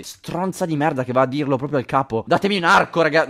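ordinary talk

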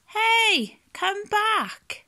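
high, loud call; calling after them. Acted. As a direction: call out to someone swimming away. Shark calling after fleeing fish.

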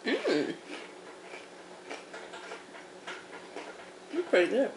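talking while chewing snack; mouth full, chewing. Real, not acted. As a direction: lips closed around food. Seen 2: Bites dipped snack at -1, closed mouth chewing throughout.

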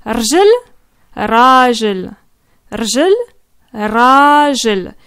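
rjel short, rajel with a long A, back and forth; rjel versus rajel. Shown as a demonstration. Teacher contrasting leg and man for a learner. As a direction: alternate quick rjel and drawn-out rajel. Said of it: "Est-ce que tu vois la différence".

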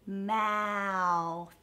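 "Mouth" with a long gliding vowel; slowed down. Shown as a demonstration. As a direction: stretch "Mouth" out slowly for a learner. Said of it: "practice slowing it down".

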